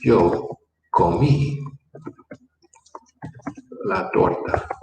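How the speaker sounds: "Yo comí" said slowly, then a pause before "la torta"; slow model sentence. Shown as a demonstration. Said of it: "what's the verb to eat?".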